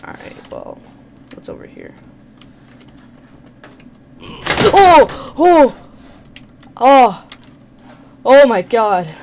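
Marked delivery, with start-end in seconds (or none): 4.2-9.1 loud scared yelps; terrified screams. Real, not acted. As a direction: scream in real fright. Jump scare.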